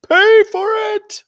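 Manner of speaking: high, loud squeal of a threat; mock threat. Threatening Mark Cox in a high loud squeal. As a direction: shriek it like a cartoon villain.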